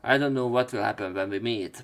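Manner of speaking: ordinary talk, nothing marked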